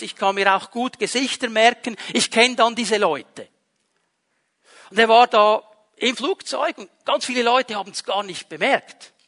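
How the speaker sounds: usual speaking voice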